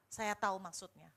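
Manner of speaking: ordinary talk, nothing marked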